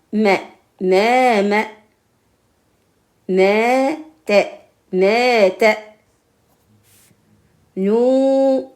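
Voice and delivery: high, loud chanted drilling of syllables. Shown as a demonstration. Teacher chants syllables for pupils to repeat. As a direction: call the syllables out like a classroom drill.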